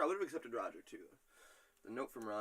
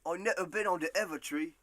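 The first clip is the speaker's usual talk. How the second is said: put-on British accent; 5 semitones higher, 6 dB louder than usual. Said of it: "I had to do that accent".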